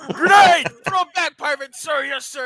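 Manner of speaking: high, loud combat shout; battlefield yell. Pretend. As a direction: yell it like under fire. Acted battlefield yelling.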